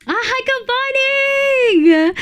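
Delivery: high, slow, sing-song hello; bright greeting. Real, not acted. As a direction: call out a happy good morning. Greeting a chat viewer.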